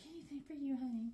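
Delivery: lightly voiced sweet friend. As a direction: croon it like a doting friend. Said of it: "She's like".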